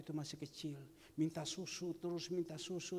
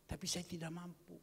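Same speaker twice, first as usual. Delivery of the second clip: ordinary delivery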